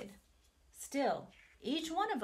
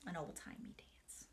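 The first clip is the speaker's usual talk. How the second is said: whispering; 4 semitones lower, 14 dB quieter than usual. Real, not acted. Unvoiced aside.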